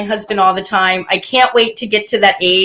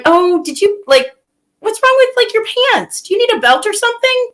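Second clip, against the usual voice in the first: much higher, falsely sweet; fake sweet high voice; 10 semitones higher than usual. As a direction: ask sweetly to hide the dig. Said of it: "I'm doing".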